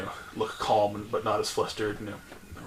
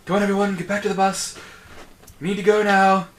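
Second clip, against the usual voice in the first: high, loud call to a crowd; yelling matron; 10 semitones higher, 9 dB louder than usual. Acted. Matron herding schoolgirls back to the bus, yelling. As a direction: yell it like a matron rounding up pupils. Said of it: "yelling in Vernosian".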